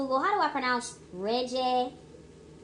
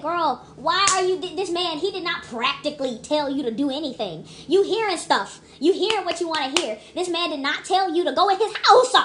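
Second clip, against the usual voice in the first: scolding the character; 5 semitones higher, 4 dB louder than usual. Retold or imitated. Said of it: "I said".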